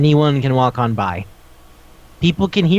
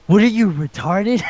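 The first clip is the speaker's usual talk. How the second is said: exasperated; 6 semitones higher than usual. Real, not acted. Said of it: "exasperated at the kid".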